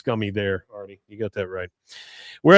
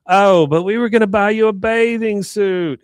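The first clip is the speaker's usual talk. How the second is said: high, louder put-on voice of a let-down date; mock disappointed woman; 8 semitones higher, 5 dB louder than usual. Woman protesting to John as he leaves, high mock disappointment. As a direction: imitate her protesting, pitched well up.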